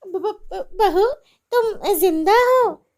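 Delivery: loud, high frightened stutter; terrified stammer. Acted. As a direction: stammer in shock. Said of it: "वो उसे देखकर डर जाती है".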